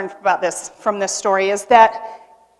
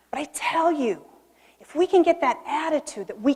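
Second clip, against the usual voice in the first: whispered aside; 2 semitones higher, 3 dB quieter than usual. Real, not acted. Confiding to audience.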